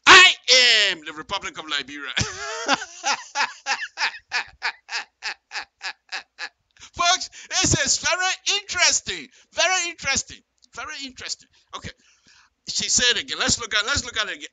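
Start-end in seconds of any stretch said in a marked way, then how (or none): 2.2-6.5 a long high laugh of delight. Real, not acted. Laughing at the politician's claim, high delighted laugh. 6.9-11.5 much higher and louder, amazed; amazed exclaim. Real, not acted. Telling his viewers, high amazed exclaim. Excited reaction to the quote.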